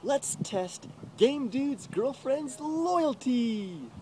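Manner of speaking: troll plotting a loyalty test, slow and high; mock troll voice. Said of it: "The troll's like".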